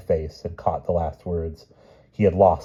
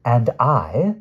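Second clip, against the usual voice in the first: icy; 6 semitones higher, 8 dB louder than usual. Acted. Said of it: "said the Queen icily".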